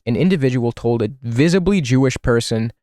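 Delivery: usual speaking voice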